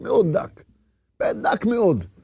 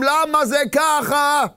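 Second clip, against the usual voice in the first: indignant inner protest; 6 semitones higher than usual. Acted. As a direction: protest it high, stretching the questions out. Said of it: "שאדם מתקומם". (מחאה מתקוממת, בקול גבוה ונמשך)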